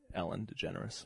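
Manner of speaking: ordinary delivery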